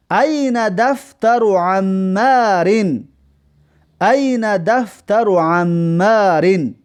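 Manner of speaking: very slow, each Arabic word spaced out; teacher's slow Arabic dictation; slow model reading. Shown as a demonstration. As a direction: read it twice, slowly, for the class. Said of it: "دفتر عمار کجاست".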